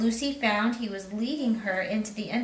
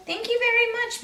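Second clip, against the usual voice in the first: put-on polite girl; 14 semitones higher, 4 dB louder than usual. Lucy voiced in story.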